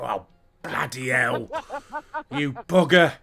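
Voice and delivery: mock Queen. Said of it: "I reckon the Queen".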